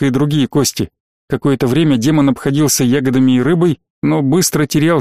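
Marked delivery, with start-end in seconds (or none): none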